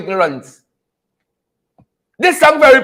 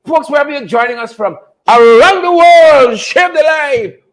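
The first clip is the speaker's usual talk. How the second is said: calling out; 3 semitones higher, 3 dB louder than usual. Real, not acted. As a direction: stretch each word out and project it to everyone. Host calling out to online viewers.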